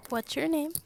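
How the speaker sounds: normal talking voice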